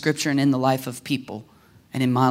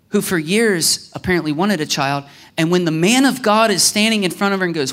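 ordinary talk, nothing marked

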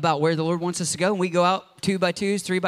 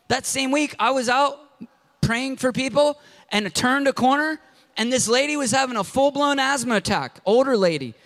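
normal talking voice

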